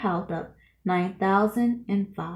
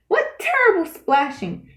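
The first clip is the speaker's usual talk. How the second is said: frowning elephant; 8 semitones higher, 3 dB louder than usual. A narrator's reading. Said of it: "the elephant frowned".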